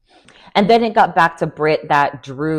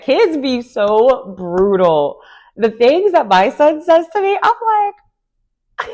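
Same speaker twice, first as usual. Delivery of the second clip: excited; 7 semitones higher, 4 dB louder, slower than usual. Real, not acted. Laughing about her son's remarks.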